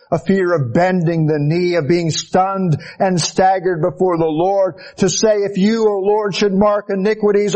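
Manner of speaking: high, sustained pulpit declamation; fervent preaching. Acted. Preaches, quoting the psalm to God.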